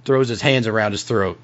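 usual speaking voice

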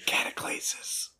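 slow breathy whisper; ASMR whisper. Pretend. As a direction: savor the word in a whisper. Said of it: "Geology A S M R".

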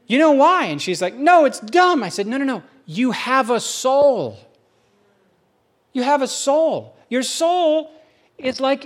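a know-it-all preacher voice. Retold or imitated. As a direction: preach at your wife like from the pulpit. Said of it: "Don't use your preacher voice on me".

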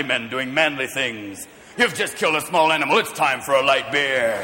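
lightly voiced low commercial announcer; beer ad voice. Mocking manly beer commercials, lightly voiced low announcer.

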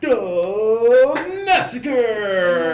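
booming slow hype announcer; monster truck ad. Pretend. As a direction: drag it out like a rally promo. Hyping up monster truck show.

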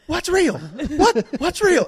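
high, baffled questions; confused sister. Retold or imitated. Sister on the phone, lost and alarmed by his screaming. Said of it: "she's like".

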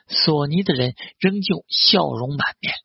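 usual speaking voice

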